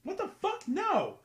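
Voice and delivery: high, loud disgusted outburst; shocked shout. Real, not acted. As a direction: recoil and yell. Disgusted at nipple request.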